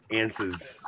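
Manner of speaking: answers with a Long Island twang; Long Island accent. Shown as a demonstration. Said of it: "my Long Island accent comes out when I say answers".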